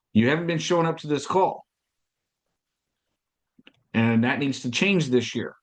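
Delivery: ordinary talk, nothing marked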